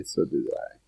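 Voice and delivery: whispered echo of own line; whispered aside. Real, not acted. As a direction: repeat it under your breath. Repeats line, second whispered.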